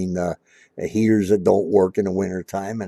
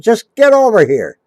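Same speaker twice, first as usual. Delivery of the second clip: barked order, much higher and louder; retold angry order; 13 semitones higher, 10 dB louder than usual. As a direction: bark it like a stern father shouting across a lot. Gruff dad orders him over.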